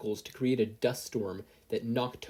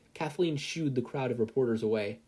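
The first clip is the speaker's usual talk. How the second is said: ordinary delivery, nothing marked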